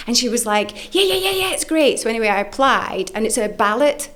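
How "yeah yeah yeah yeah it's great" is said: much higher, gushing yeahs; eager friend. Retold or imitated. Zoe eagerly agreeing to host her, gushing. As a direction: rattle off yeahs like a keen friend.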